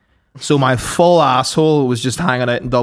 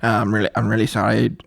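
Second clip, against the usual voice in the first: meek, apologetic Dublin security man; a sorry, soft-spoken Dublin airport guard asking you aside; Dublin accent; 5 semitones lower, 6 dB quieter, faster than usual. Retold or imitated. Mimicking the Dublin airport guard, apologetic Dublin accent. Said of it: "that was me doing Dublin".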